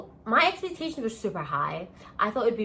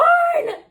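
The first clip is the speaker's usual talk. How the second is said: shrieking laugh; 10 dB louder than usual. Real, not acted. Laughs after mock yell.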